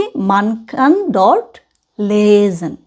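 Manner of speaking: very slow, each word apart; chopped phrasing. Shown as a demonstration. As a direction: break man kann dort lesen into separate slow pieces. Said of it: "ছাড়া ছাড়া এইভাবে করে বলবো". (ছাড়া ছাড়া ধীরে বলা)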